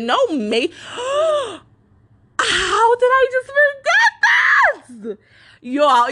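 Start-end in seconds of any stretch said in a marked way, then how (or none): 0.7-1.5 high startled gasp; sharp gasp. Real, not acted. Suddenly remembers forgotten story. 2.4-5.2 high, slow shocked shout; shocked disbelief. Real, not acted. Suddenly remembers forgotten story.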